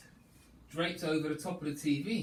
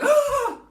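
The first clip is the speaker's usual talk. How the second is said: shocked scream; 15 dB louder than usual. Acted. Man in kitchen shocked.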